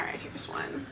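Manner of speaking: soft whisper. Real, not acted. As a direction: whisper it under your breath. Quietly picking a review.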